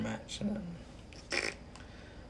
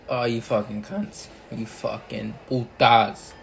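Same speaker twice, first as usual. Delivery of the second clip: ordinary delivery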